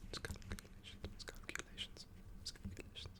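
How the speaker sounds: breathy repeated murmur, lost in thought; whispered musing. Acted. Judge mulling the machine's error, whispering. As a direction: whisper the word over and over, pondering. Mulls the word over to himself.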